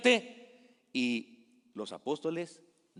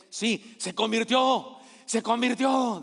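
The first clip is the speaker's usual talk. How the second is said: high and louder, slow and insistent; excited news; 6 semitones higher, 4 dB louder, slower than usual. Acted. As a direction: announce it like a miracle.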